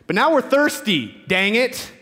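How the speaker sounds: high-pitched mock whine; petulant complaint. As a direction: grumble it like an ungrateful crowd. Voices the people complaining again.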